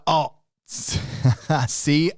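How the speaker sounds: amused "oh", then a laugh; laughing at own slip. Real, not acted. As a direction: caught out, breaking into a chuckle.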